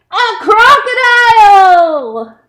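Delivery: a loud, high, startled cry of discovery; surprised shout. Acted. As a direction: shout the big reveal to the children. Big reveal at end of story.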